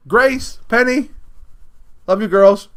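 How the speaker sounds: loud, high shout-out to friends; calling out. Real, not acted. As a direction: project it brightly to friends far away.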